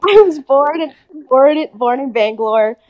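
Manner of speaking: booming mock-profound start to a life story; mock-epic. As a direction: launch it like an epic saga, hamming it up. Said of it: "So deep".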